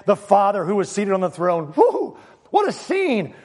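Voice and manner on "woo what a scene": high whoop of wonder; whoop, then marvel in a high voice; thrilled amazement. Real, not acted. Marvels at the scene described.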